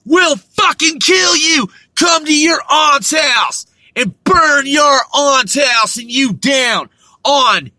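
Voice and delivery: loud, high, slow menacing yell; repeating the Klan kids' threats as a slow, loud, high yell; shouted Klan threat. Retold or imitated. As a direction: threaten like a hooded thug. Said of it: "That's how I was talked to".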